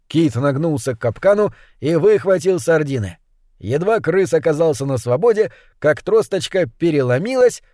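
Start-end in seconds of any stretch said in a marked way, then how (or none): none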